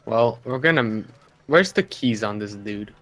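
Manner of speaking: ordinary talk, nothing marked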